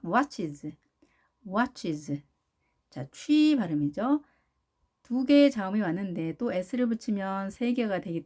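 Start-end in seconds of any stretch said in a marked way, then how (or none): 0.0-2.2 "watches" stressed on "wa", ending falling; stress on first syllable. Shown as a demonstration. Teacher models "watches" for learners, first syllable stressed. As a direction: lean on the "wa" of "watches", let "ches" drop. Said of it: "아의 이 강세가 있겠습니다".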